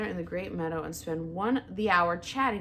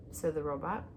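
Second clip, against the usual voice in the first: plain reading voice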